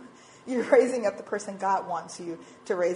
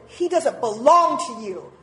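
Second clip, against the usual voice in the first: slow, louder, deliberate rebuke; firm correction; 3 semitones higher, 8 dB louder, slower than usual. Retold or imitated. God correcting a jealous mother, slow and firm. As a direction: state it plainly with weight on "belong". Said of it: "just like that in my spirit".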